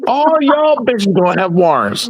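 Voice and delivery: high-pitched hammed-up warning to a crowd; mock threat. Riffing on Training Day for his co-host, high mock threats. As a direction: threaten everybody in a high put-on voice.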